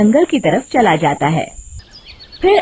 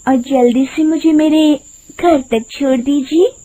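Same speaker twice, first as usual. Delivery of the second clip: high-pitched sweet request; higher-voiced woman asking for a lift; pleading woman in a fairy tale; 6 semitones higher than usual. Woman asks the cart driver for a ride home, pleading.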